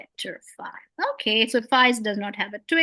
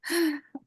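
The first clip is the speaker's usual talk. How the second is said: a breathy tired sigh; 6 semitones higher, 4 dB quieter than usual. Shown as a demonstration. Teacher demonstrating a tired sigh for ha. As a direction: sigh out like you're worn out. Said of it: "if you become tired, what do you say".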